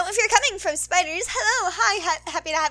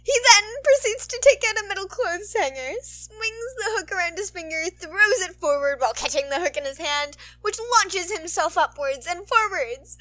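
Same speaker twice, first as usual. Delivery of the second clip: lively, upbeat fic reading; story narration; 5 semitones higher, 6 dB louder than usual. A narrator's reading. Narrator reading the fic to listeners, lively narration. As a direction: read it out like a storyteller.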